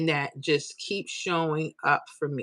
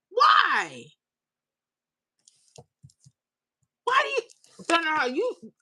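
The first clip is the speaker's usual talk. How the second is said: very high-pitched, stammering disbelief; dismayed protest; 11 semitones higher, slower than usual. Real, not acted. Streamer reacting to a viewer hating Florida, dismayed. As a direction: squeal "why" in wounded disbelief.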